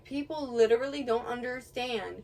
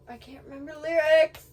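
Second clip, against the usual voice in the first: rising, loud frustration; exasperated near-shout; frustrated; 5 semitones higher, 9 dB louder than usual. Real, not acted. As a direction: build louder and higher as the memory fails. Blanks on the song's lyrics.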